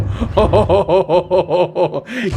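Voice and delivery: big delighted laugh; loud cackle. Real, not acted. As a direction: cackle at the chaos. Laughing at the carnage.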